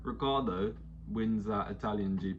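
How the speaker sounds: usual speaking voice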